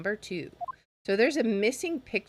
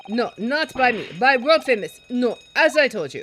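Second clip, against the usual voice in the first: sailor shouting; 4 semitones higher, 8 dB louder than usual. Acted.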